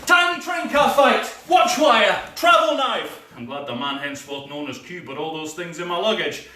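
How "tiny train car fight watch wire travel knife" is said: high, loud callouts; action callouts. Acted. Bond narrating the train fight, excited. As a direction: shout each move.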